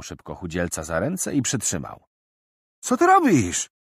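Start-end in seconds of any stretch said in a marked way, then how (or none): 2.8-3.7 very high, loud startled yell. Acted. Seized thief yelling at Tarik, loud. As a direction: shriek at being grabbed. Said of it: "wrzasnął chłopak".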